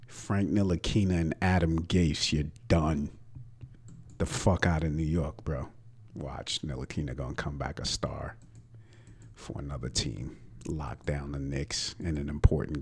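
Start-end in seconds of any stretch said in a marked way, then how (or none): none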